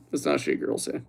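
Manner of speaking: voiceless whisper; hushed disbelieving whisper; whispered repeat. Pretend. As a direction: breathe it as an aside. Repeated whisper after plain take.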